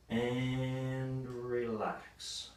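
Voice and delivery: drawn-out, soft "relax"; slow release cue. Real, not acted. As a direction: let the word stretch out slowly. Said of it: "coach ending the set".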